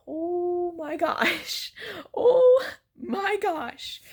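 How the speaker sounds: high, drawn-out swoon; acted-out breathless "can't believe it"; overwhelmed gushing. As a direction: retell it as a long, high-pitched gasp of delight. Retelling her overwhelmed reaction to proposal.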